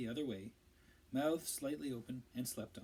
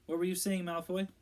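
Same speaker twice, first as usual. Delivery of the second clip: challenging; 6 semitones higher, 6 dB louder than usual. A narrator's reading. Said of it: "in case he needed to hold Ron back".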